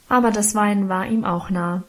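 ordinary delivery, nothing marked

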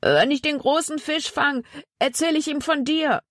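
high-pitched call over a distance; calling back. A narrator's reading. Zack looks back at Jonathan while fleeing, calling back.

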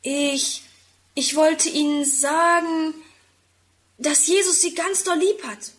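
plucking up courage. A narrator's reading. Tim nervously tells Frau Keller Jesus loves her, hesitantly. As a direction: draw out the first words, then get it said. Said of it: "Tim sammelt seinen ganzen Mut zusammen".

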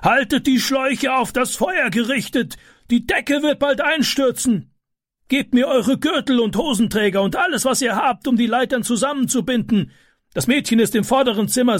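a man directing a rescue; commanding neighbour. A narrator's reading.

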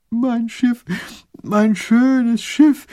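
high, slow whiny wail; whining lament. Acted. Little grand duke wailing over his lost ship, high-pitched. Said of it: "jammert der kleine Großherzog".

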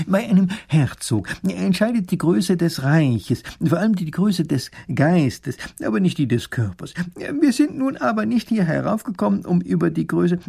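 smooth things over with lofty explanation; hemming, diplomatic professor. A narrator's reading.

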